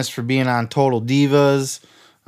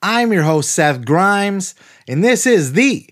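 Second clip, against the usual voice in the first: high, slow hyped announcer read; announcer intro; 6 semitones higher than usual. Acted. Host opening the wrestling podcast, announcer hype. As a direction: sell the show like a big promo.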